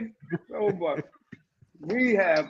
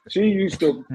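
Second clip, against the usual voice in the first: ordinary delivery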